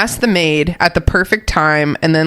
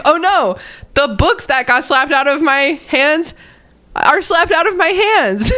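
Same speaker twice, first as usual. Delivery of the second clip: high, whiny mock lament; dramatic girl voice; 8 semitones higher than usual. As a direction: wail it like a dramatic kid. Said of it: "she's like".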